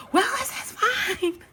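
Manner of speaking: very high, drawn-out mock wail; laugh-crying. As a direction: imitate talking through tearful laughter. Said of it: "laugh crying".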